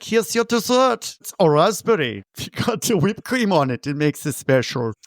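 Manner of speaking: high-pitched hostess serving dessert; teasing high impression of a relative; mimicked relative. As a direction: present it proudly in a high mock voice.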